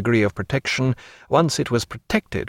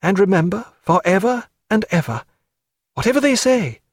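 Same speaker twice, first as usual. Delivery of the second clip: higher, heartfelt farewell; tender young woman; 8 semitones higher than usual. Acted. Sophia bids Jack farewell, high and heartfelt. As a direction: promise it forever, higher.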